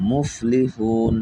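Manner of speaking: slow, drawn-out recitation. Shown as a demonstration. Teacher reading the joined word to students, slowly. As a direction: read the whole word slowly. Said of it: "teacher reads the joined word".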